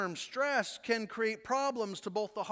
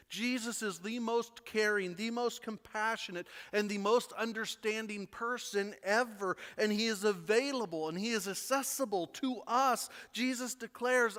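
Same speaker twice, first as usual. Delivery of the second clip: ordinary talk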